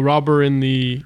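ordinary talk, nothing marked

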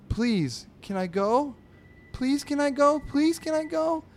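put-on high-pitched, repetitive kid begging; pleading boy. Boy pestering his sister at the table, high pleading. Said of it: "You sound like a broken record".

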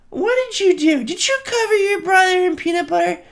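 high scolding mom voice; high-pitched parent asking sternly. Retold or imitated. Voicing mom scolding child in video.

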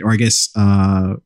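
normal talking voice

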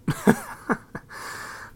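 high, wheezy fit of laughter; real laugh. Real, not acted. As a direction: laugh helplessly at your own joke. Laughs at own butt joke.

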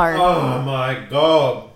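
very slow pained groan; after eating wasabi. Real, not acted. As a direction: drag it out in pain. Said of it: "That was fucking awful".

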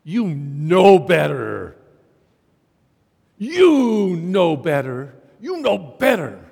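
slow, loud, heavy-hearted parent scolding; stern parent. Retold or imitated. Parent scolding a teenager, slow and loud. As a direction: lay it on like a disappointed father. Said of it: "They look at their kid and say".

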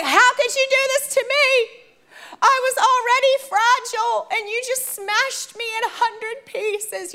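high-pitched, wounded mock wail; hammed-up tearful outrage; retold angry outburst. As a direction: act out a hurt, angry accusation high in the voice. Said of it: "I am so angry".

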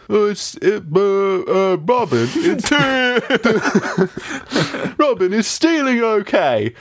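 high, hesitant cartoon sidekick; hammed-up timid questioning; worried Little John. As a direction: stammer it out in a high put-on voice. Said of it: "Little John's like".

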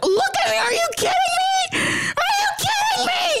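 high, shrieking outrage; hammed-up furious shout; mock angry yell. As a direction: scream it like you can't believe it. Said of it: "That's how I feel when I get rejected".

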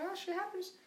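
high, offhand shrug of a line; flippant. Retold or imitated. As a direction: brush it off like nothing. Flippant reply to principal.

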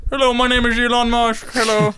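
quick, slurred mock-accent impression; goofy caricature of a billionaire; Elon Musk. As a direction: do a silly foreign-accented tech mogul. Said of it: "That's how he sounds".

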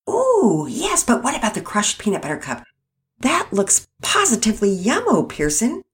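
put-on high, eager kid voice; excited. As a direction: gush over the treats. Penelope excited over ice cream flavors.

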